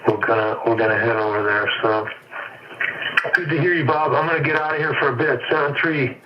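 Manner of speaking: normal talking voice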